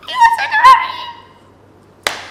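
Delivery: very high, giddy squeal with a laugh, slowing on marry him; excited squeal. Retold or imitated. Retelling her dream reply to the proposal, thrilled high squeal with a laugh. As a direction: squeal it out like a thrilled girl in love. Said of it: "So I was excited".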